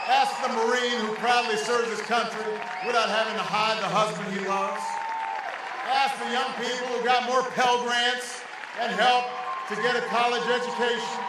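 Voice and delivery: loud, rousing rally speech; podium speech. Real, not acted. Rally crescendo in campaign speech.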